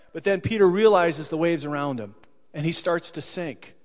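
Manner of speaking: ordinary delivery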